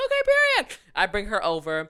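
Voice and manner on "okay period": high, loud, breezy self-assurance; mock confident. Student agreeing to translate for teacher, mock confident. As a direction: chirp it out, cocksure, sending yourself up. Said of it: "You're, like, so confident".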